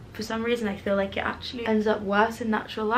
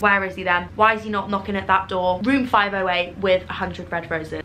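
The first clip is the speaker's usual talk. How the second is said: ordinary talk, nothing marked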